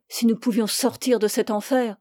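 usual speaking voice

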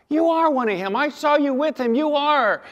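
put-on high-pitched insistent girl's voice; a young maid accusing Peter; servant girl. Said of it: "she comes up and goes".

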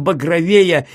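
ordinary delivery

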